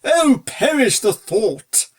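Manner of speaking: slow, loud horrified cry; cried too loudly. A narrator's reading. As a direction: overdo the outcry, a bit too loud. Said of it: "cried the butler, just a little too loudly".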